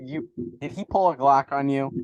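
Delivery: ordinary talk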